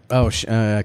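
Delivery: ordinary talk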